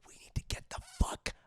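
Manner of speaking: acted, hushed whisper; mock-panicked whispering; urgent whisper. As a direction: hiss it secretly to a friend. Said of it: "Chip's like".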